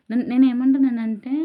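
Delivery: emphatic. Real, not acted. Emphatic point to viewers.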